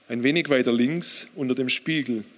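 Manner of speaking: ordinary talk, nothing marked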